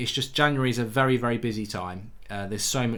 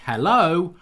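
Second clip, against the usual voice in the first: louder, higher incredulous "Hello?"; ironic hello; 5 semitones higher, 6 dB louder than usual. Real, not acted. Fan channel host challenging radio pundits' hypocrisy, ironic hello. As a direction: call out hello as if they've missed the obvious. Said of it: "being ironically saying hello".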